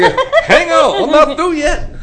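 high, loud, amused protest; loud laughing protest. Real, not acted. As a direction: shout over the laughter to keep the floor. Holding the floor amid laughter.

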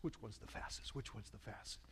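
fading whisper to himself; a whisper that trails away to nothing; whispered muttering. Retold or imitated. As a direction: mutter it under your breath as you scan the gates. Scanning gates, muttering to himself.

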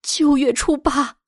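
slow, high, trembling answer. A narrator's reading. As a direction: answer shakily, drawing it out in dread. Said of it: "大老婆颤抖着声音答道".

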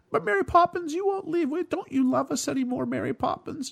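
put-on very high whiny kids' voice; pleading children. Retelling the film, children begging Mary not to leave. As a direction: plead like a clingy little child. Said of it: "they're like".

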